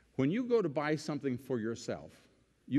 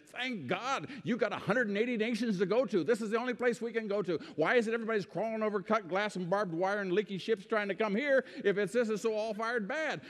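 fired-up retort; 8 semitones higher than usual. Retold or imitated. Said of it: "I would say".

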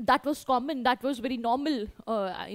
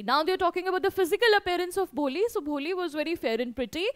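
normal talking voice

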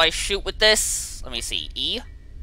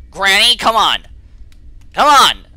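loud, impatient shout; raised voice, urging; impatient call; 3 semitones higher, 7 dB louder than usual. Real, not acted. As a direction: call out to hurry someone along. Calls out impatiently to Granny.

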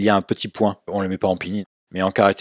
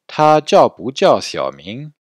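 slow, higher and louder Mandarin; slow repeat-after-me model; 4 semitones higher, 5 dB louder, much slower than usual. Shown as a demonstration. Teacher has students repeat after her, slow model. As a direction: project each syllable slowly for learners to copy. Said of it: "Répétez".

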